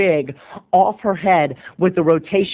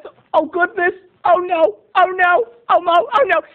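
much higher, fretting oh no; mock panic; 11 semitones higher than usual. Teacher waking to a sex scene, high flustered panic. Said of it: "she's like".

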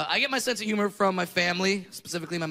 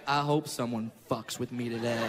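low, softer cocky undertone; swaggering; 9 semitones lower, 5 dB quieter than usual. Retold or imitated. Boy walking into kindergarten ready for insults, quiet swagger. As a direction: drop the pitch and invite trouble coolly. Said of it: "with some swag".

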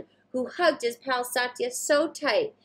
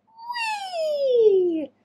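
much higher gleeful squeal; whee; 4 dB louder than usual. Acted. Satya zooming down the slide, gleeful squeal. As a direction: shout with joy down the slide. Sliding down.

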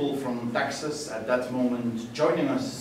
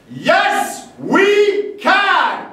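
loud, much higher rallying shout; shouted slogan; 13 dB louder, much slower than usual. Acted. Poet closing the poem with the rally slogan, shouting. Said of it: "famous slogan shouted".

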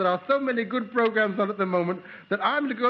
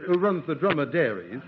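normal talking voice

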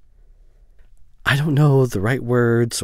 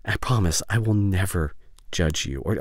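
ordinary talk, nothing marked

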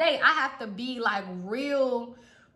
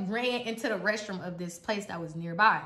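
normal talking voice

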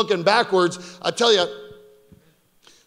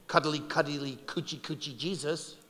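put-on much softer, lower cooing baby talk; mocking baby talk; 6 semitones lower, 14 dB quieter than usual. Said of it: "Here's a beauty".

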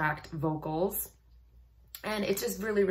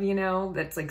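ordinary talk

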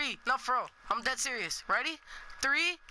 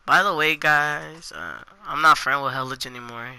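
loud announcement; 8 semitones lower, 10 dB louder than usual. Real, not acted.

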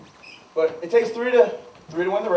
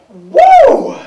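cheer whoop; 15 dB louder than usual. Acted. [name] cheers making it.

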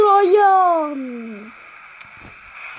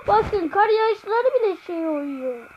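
ordinary talk, nothing marked

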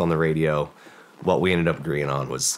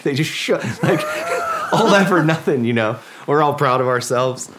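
higher and louder, laughing through the words; 10 semitones higher, 6 dB louder than usual. Real, not acted. Veteran laughs telling the host about the wasted effort, laughing. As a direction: laugh at how it all came to nothing.

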